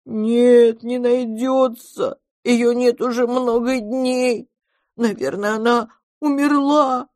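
high, loud, drawn-out monster wail; bitter sobbing. A narrator's reading. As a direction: wail it slowly like an inconsolable little one. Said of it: "ещё горше зарыдало чудовище".

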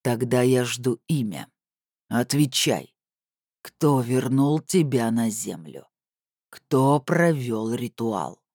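slow, heavily weighted demands; deliberate, commanding villain; menacing interrogation. A narrator's reading. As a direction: draw out each question like a threat. Captor interrogates his prisoner.

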